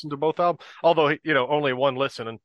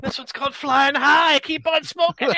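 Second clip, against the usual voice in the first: rock concert banter; 11 semitones higher, 5 dB louder than usual. Pretend. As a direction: shout it like a stage rocker. Mock song intro, shouted.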